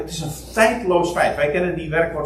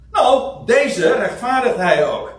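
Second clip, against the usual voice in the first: ordinary talk, nothing marked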